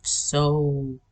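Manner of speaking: slow, imitating the old voice; slowly enunciated. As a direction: stretch each sound out. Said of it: "the letters and the sounds were enunciated".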